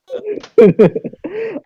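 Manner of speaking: squeaky high laugh; high-pitched giggle. Real, not acted. Laughing at own joke.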